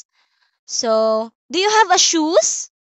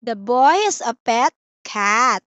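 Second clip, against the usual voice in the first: ordinary talk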